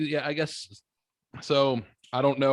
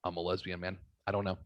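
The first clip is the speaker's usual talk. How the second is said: softer, lower, offhand mimicry; mumble-soft, as if imitating someone at a loss; imitated quiet shrug; 5 semitones lower, 7 dB quieter than usual. Imitating the daughter's answer to his questions, quiet and offhand.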